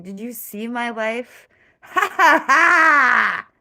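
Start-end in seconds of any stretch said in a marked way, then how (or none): 1.8-3.5 loud burst of cackling laughter. Real, not acted. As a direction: break into a big real laugh. Laughing at own joke.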